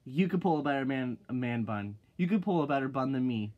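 normal talking voice

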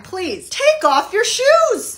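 slow, drawn-out firm request; slow and firm, no arguing; insistent. Acted. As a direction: lean on it, shoes off. Insisting on shoes off.

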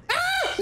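a very high, sudden "Ah!"; shriek of shock. Retold or imitated. Screams at the fan's lewd remark, a very high shriek. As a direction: shriek like the words just hit you.

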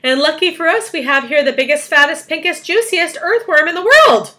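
high, loud, relishing each superlative; excited build-up. A narrator's reading. James presenting the earthworm as bait, excited. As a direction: build loudly to a triumphant reveal.

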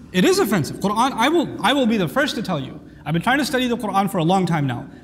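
usual speaking voice

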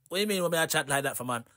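quick, hushed mock patois reply in a London Jamaican lilt; imitating Jamaican patois. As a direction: brush off the question in light Jamaican patois. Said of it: "Why are you talking like that?".

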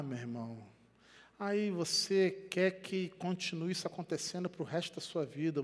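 ordinary talk

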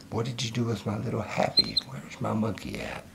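soft, high teasing coo; playful baby talk. Pretend. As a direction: coo mock questions at a pet.

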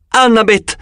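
desperate cry. A narrator's reading. Wounded Luke crying out to Annabeth for help. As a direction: cry out her name from the ground. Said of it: "gridò".

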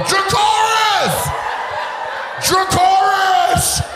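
high, slow drawn-out shouted commands; mock dragon-rider yell. Comic yelling at the wall like a dragon rider, high slow shout. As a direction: call the dragon dramatically, stretched out. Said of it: "I be yelling at the wall".

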